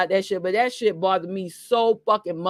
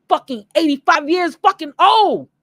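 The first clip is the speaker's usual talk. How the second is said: shouting; 5 semitones higher, 8 dB louder than usual. Real, not acted. Ranting at absent father.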